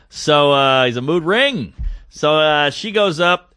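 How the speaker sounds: usual speaking voice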